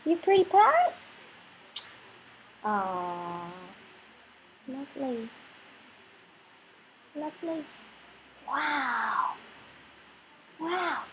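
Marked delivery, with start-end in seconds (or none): 2.6-11.0 hushed cooing. Real, not acted.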